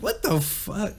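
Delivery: usual speaking voice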